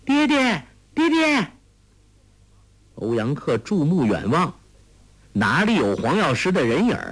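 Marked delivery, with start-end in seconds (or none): 0.1-1.5 very high, ringing cry; high-pitched daughter's call. A narrator's reading. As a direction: hail father far down the beach. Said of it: "向着海滩扬手呼叫".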